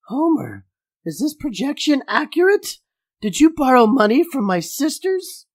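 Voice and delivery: high, raspy, appalled wife voice; way up high and scandalised; Marge voice. Acted. Voicing Marge confronting Homer.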